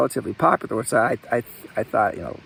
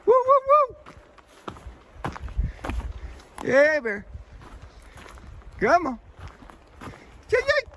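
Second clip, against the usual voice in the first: bear calls. Real, not acted. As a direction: call out to warn bears. Hiker calling out to bears.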